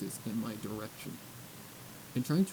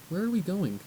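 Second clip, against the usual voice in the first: eager filly question; 7 semitones higher, 5 dB louder than usual. Acted.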